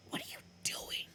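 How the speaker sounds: soft breathy astonished whisper; hushed amazed whisper. Acted. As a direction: whisper in disbelief. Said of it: "in an amazed whisper".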